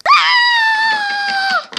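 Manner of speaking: very high sustained shriek; acted scream of alarm; long panicked scream. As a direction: scream on one held high note.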